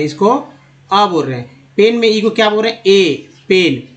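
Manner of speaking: ordinary delivery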